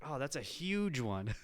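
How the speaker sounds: ordinary talk, nothing marked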